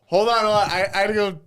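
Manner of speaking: mock urgent. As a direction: announce it like you must rush off. Mock-voicing friend leaving the table.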